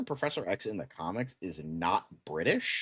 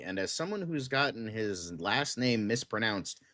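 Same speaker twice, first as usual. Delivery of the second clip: usual speaking voice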